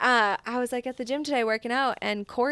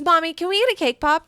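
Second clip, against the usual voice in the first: put-on eager little girl; 7 semitones higher, 7 dB louder than usual. Daughter voiced asking for treat.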